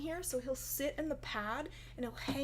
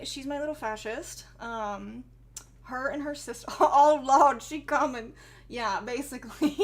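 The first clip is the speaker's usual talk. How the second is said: ordinary talk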